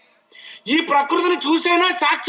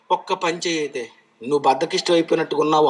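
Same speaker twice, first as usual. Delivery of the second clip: voicing God; 10 semitones lower, faster than usual. Preacher voices God scolding lazy man, low and quick. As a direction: rattle it off fast in a hushed God voice. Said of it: "ఆయన ఏమంటున్నాడు తెలుసా?".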